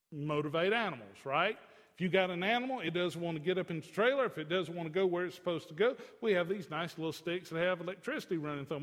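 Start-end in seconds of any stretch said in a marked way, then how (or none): none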